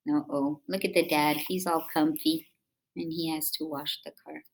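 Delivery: usual speaking voice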